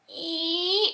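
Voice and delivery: imitated furniture screech, pitched high; high scraping squeak. Copying the neighbor's dragged furniture with a high squeak. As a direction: mimic a chair dragging across the floor.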